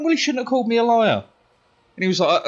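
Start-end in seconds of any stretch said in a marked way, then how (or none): 0.0-1.2 high, fast aggrieved comeback; indignant retort. Retold or imitated. Pupil answering head of year's telling-off, indignant. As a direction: fire back quickly, pitched right up. Talking back to head of year.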